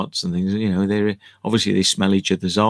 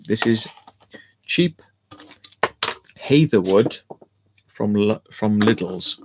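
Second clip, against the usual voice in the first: ordinary talk, nothing marked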